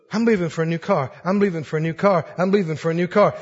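low mock grumbling; mock grumbler. A discontented believer grumbling over an old car, low and sulky. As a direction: mumble like a sulker.

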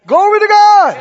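loud, high cry of praise; exclaimed praise. Real, not acted. Preacher crying out to the congregation, loud and high. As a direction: burst out praising God.

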